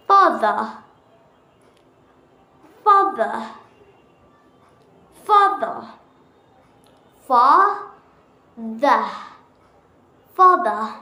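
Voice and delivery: Father repeated in a British accent. Shown as a demonstration. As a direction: drill Father slowly, the British way. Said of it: "you have to practice".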